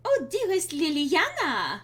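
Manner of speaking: mock French swoon, drawn out; Marjolaine's French accent. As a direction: ham up a lovesick French noble. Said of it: "better than Marjolaine's".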